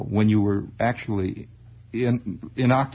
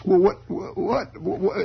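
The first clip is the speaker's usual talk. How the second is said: put-on high, slow stammering boy; flustered kid; 8 semitones higher, slower than usual. Boy stalling his father, high stammer.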